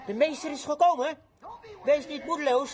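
high, projected announcement; ringing preacher's proclamation; acted joyful summons. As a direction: call out the news to someone waiting. Disciples call the blind man.

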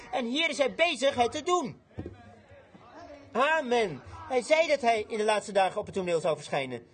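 high-pitched, emphatic proclamation; impassioned preaching. Acted. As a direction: drive each promise home to the congregation. Preaches at full force.

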